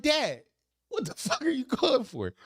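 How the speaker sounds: very high, baffled mock complaint; exasperated high-pitched son; incredulous protest. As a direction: squeal the question at dad in disbelief. Acted baffled reply to dad.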